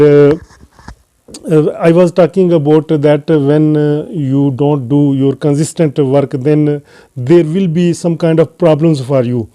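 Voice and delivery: ordinary talk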